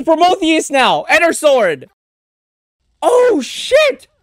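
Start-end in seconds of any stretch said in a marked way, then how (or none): 3.0-4.1 very high excited yelp; real astonished outburst; shocked. Real, not acted. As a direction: squeal it in amazement. Shocked by fight on screen.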